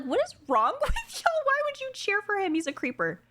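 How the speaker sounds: high, incredulous mock scolding; mock outrage. Streamer retelling her reaction to fans cheering Grima, mock outrage. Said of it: "I'm like".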